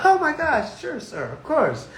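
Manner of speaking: lightly voiced polite clerk, slowing down; a hint of a clerk eager to help; eager bank clerk. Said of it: "They gonna be like".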